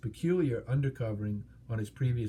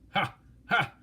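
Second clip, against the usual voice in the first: mock laugh; 8 semitones higher, 4 dB louder than usual. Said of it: "laughed Smaug".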